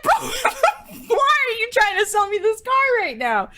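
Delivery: high laughing squeal of disbelief; very high mock-incredulous shout; exasperated disbelief. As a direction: cry out in hammed-up exasperation. Retold exasperated reply to clueless salesman.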